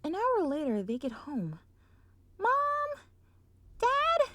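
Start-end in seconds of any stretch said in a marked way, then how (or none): 2.4-4.3 high, loud call through the house; yelling out. Acted. Sweetie Belle calling her parents, loud high shout. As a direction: call them loudly. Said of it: "she yells out".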